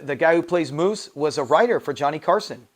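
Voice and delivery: ordinary talk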